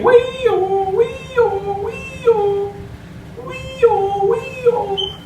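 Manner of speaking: very high squeaky cartoon mouse sing-song; Mickey Mouse voice. Shown as a demonstration. Tutor demonstrating the Mickey voice to viewers. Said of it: "Start saying".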